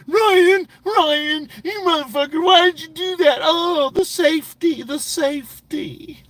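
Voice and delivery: high, whiny panicked mimic; mocking Chris Ruff. Streamer mocks rival panicking at friend. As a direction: squeal it like a scared guy blaming his friend. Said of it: "He's probably going".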